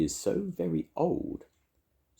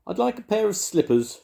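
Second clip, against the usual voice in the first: high, loud granny request; announcing Grandma; 6 dB louder than usual. A narrator's reading. Grandma ordering in the butcher's, high loud announcement. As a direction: announce it loudly, pitched up. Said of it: "she announced".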